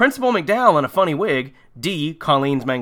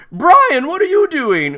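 loud, high-pitched imagined victim yell; mock scream; 13 semitones higher, 6 dB louder, slower than usual. Victim naming her murderer, mock scream. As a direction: cry out in mock horror. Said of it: "All she does manage to scream".

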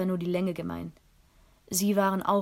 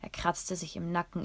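plain reading voice

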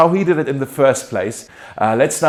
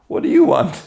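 much higher, curt mock question; funny blunt Jesus; 12 semitones higher than usual. Preacher mimicking Jesus turning to disciples, comically blunt. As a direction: play it for laughs, blunt and abrupt. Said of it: "I think that's funny".

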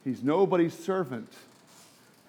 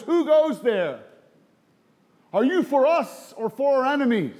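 Joshua's challenge; 7 semitones higher than usual. Retold or imitated. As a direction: shout the challenge at the armed man. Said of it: "He goes".

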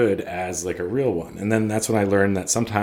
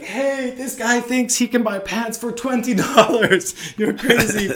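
very high, slow, derisive holler; mock shout to the back; 3 dB louder, slower than usual. Tailor shouting to the back about a cheap customer, mocking. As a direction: imitate a scornful call across the shop, laughing. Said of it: "he shouts in the back".

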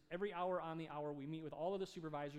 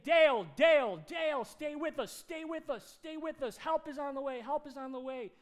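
loud, high pleas to a man slipping away; re-enacted emergency shouting, high and insistent; urgent calling out; 8 semitones higher, 13 dB louder than usual. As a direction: shout to keep him with you. Urging an unconscious man to hold on.

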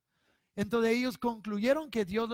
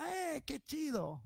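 slow, higher, soft mock delight; mock casual; 6 semitones higher, 5 dB quieter, slower than usual. Preacher mocking a careless reaction, slow and breezy. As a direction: play it as a shallow, lazy "cool". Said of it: "solo para que digamos".

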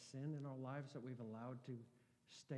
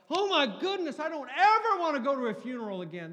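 much higher and louder, strained outcry; boy overwhelmed at his first funeral, shrill dismay; horrified child; 13 semitones higher, 22 dB louder than usual. Retold or imitated. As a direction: cry out like a shaken eleven-year-old. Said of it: "I was like".

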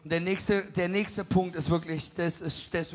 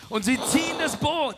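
raised, loud narration; match the drama, high and loud; excited storytelling; 6 semitones higher, 5 dB louder than usual. A narrator's reading. Interpreter echoing the preacher's fishing story, excited storytelling.

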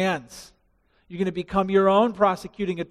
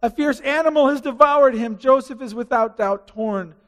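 lightly voiced Jacob's lament; grieving father; 6 semitones higher, 7 dB louder than usual. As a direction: cry over the torn robe.

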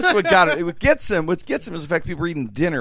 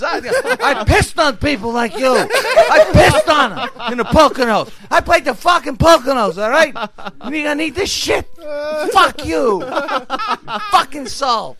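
high, loud, slow-hammered tirade; hammed-up furious rant at the crowd; ranting breakdown; 11 semitones higher, 6 dB louder, slower than usual. Comic getting off on the audience over salt, raging. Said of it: "Rummy would have the breakdown".

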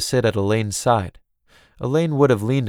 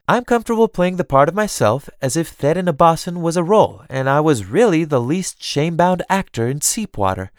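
high, animated self-mocking grandness; playfully boastful cousin; 6 semitones higher than usual. Theron tells Elaine why she loves her, playful boasting. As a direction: show off with theatrical flair.

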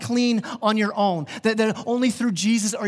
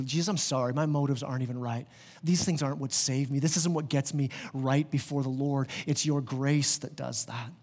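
soft, hushed confessional prayer; praying low, as if alone with God; quiet prayer; 6 semitones lower, 7 dB quieter than usual. Acted. Preacher voicing a sinner's prayer to Jesus, hushed.

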